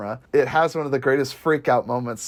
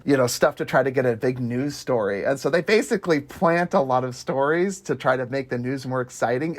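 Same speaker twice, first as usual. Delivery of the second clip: ordinary talk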